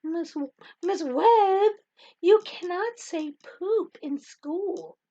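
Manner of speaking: put-on high, slow, drawn-out child voice; scolding little kid. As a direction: reproach teacher like a second grader. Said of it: "these two little kids".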